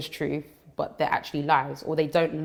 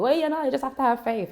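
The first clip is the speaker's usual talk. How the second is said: higher-pitched put-on Jamaican accent, joking; playful Jamaican accent brushing off the question; mock Jamaican accent; 9 semitones higher than usual. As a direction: spoof a Jamaican churchgoer's answer. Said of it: "I don't know why I picked a Jamaican accent".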